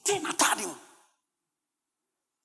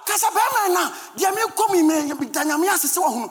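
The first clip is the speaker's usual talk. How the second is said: loud, high, shouted sermon delivery; fiery Ghanaian pulpit shouting; full-force preaching; 8 semitones higher, 5 dB louder than usual. Acted. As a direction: preach it hard at the congregation.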